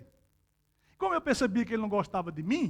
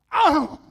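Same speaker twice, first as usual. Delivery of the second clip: loud imitated parrot screech; mock squawk; 4 semitones higher, 7 dB louder than usual. Preacher showing the parrot's attack screech, loud. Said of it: "ele faz assim, ó".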